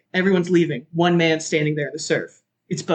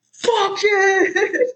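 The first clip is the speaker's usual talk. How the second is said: retold wild yell. Johnny leaping from the plane, wild yell. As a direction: shriek it as you jump.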